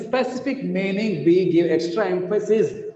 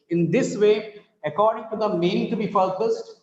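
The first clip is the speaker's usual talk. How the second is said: normal talking voice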